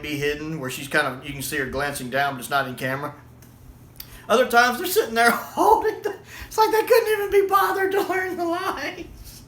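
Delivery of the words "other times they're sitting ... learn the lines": rising into a helpless squeal of laughter; laughing through words. Real, not acted. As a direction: push the sentence out while cracking up. Cracking up over scripts in hand.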